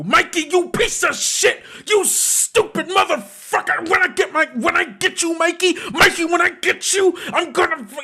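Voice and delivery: high-pitched, furious in-character yelling; upset yelling. Acted. Player yelling at Mikey while held back from attacking him, upset yelling. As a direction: flail and yell in a high, upset voice. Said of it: "like, yelling and, like, upset".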